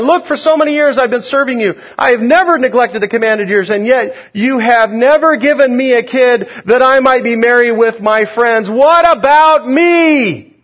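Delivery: high, loud, whining complaint rising to a wail on what about me; whiny older brother. Acted. Preacher voicing the jealous older brother, high whining complaint. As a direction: sulk and whine like the jealous son who feels cheated.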